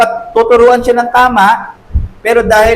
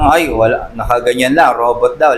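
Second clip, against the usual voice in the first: dropped low, dismissive "ay, wala"; lightly mocked flat, unfeeling churchgoer; 8 semitones lower than usual. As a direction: play the emotionless robot believer, lower. Mimicking cold unfeeling Christians.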